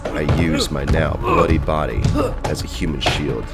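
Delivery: ordinary delivery, nothing marked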